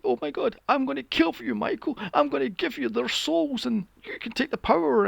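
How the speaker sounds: high, breathless cult devotee voice; mock fanatic follower. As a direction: gush it out like a starstruck disciple. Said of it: "it's like".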